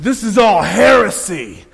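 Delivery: mock fire-and-brimstone rant; preacher's condemnation. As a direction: denounce it like an angry preacher. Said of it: "He said".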